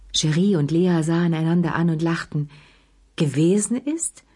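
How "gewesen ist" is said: "Gewesen ist" stressed, rising into a question; pointed emphasis. A narrator's reading. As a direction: echo "Gewesen ist" pointedly, pitch climbing at the end. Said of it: "betonte Lea".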